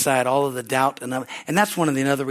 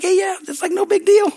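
mock casual; 6 dB louder than usual. Villagers' casual reaction retold.

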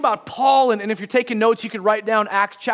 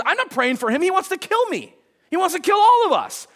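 a higher, rising cry of alarm; alarmed protest; 8 semitones higher than usual. Retold or imitated. Ananias protests to the Lord in higher-pitched alarm. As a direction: panic at the thought of praying for a killer.

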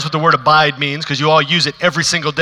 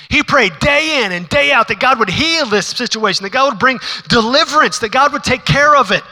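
rising sermon fervor; preaching at full force; 6 semitones higher than usual. Acted. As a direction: drive it home from the pulpit. Preacher builds in intensity.

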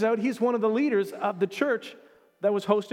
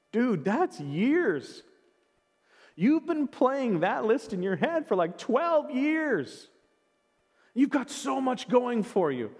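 mildly incredulous, higher, drawn-out "years"; retold disbelief; 5 semitones higher, slower than usual. Preacher retelling his reply to a discouraged man, mildly incredulous. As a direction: stretch it out in mock disbelief.

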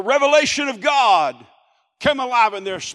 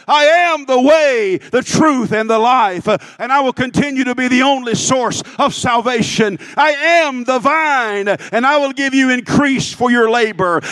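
building to loud, driving proclamation; fervent pulpit climax, voice raised; full-force preaching; 2 semitones higher, 7 dB louder than usual. Real, not acted.

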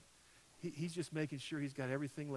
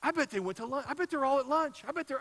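rising higher and louder with each guess, working himself up; worked-up rant; 9 semitones higher, 9 dB louder, faster than usual. Retold or imitated. Pastor retelling his spiral of suspicion to the congregation, frantic and rising. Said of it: "I just started in and going on".

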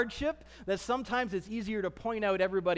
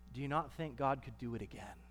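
much softer, quicker, intimate question; hushed challenge; 9 semitones lower, 13 dB quieter, faster than usual. Real, not acted. Pastor quietly challenging the congregation's doubt, hushed. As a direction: lean in and ask it softly.

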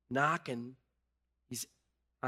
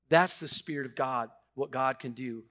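usual speaking voice

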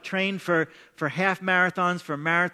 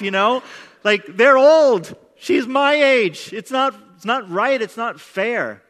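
distraught outburst; 3 semitones higher, 6 dB louder than usual. Retold or imitated. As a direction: vent to God louder and louder, then let it drop at the end. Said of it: "I hang up the phone and I'm like".

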